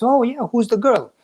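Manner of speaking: a hint of a nosy adviser perking up; curious old minister. Minister asks the lovesick prince.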